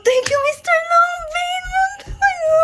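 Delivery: restrained squeal. Real, not acted. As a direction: squeeze the thanks out high without shouting. Thanking while trying not to scream. (pigil na matinis na tili)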